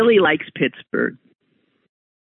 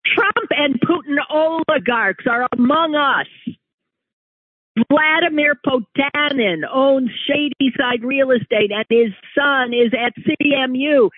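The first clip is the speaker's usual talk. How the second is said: higher, mock-breathless reading of a panicky post; mock alarmed reading; 11 semitones higher than usual. Host mocking a neighbor's Facebook post, higher mock alarm. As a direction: read it like an overexcited alarmist. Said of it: "had something on his Facebook page which reads".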